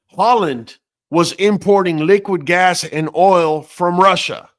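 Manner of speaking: normal talking voice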